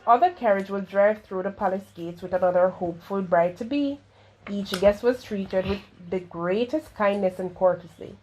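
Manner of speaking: plain reading voice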